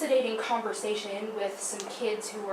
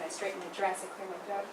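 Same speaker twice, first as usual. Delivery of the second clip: normal talking voice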